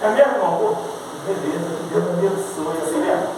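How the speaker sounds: low, soft, half-hearted blessing; indifferent brush-off. Acted. Churchgoer answering another's testimony, unconvinced and subdued. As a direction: politely dismiss it under your voice.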